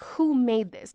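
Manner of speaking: ordinary talk, nothing marked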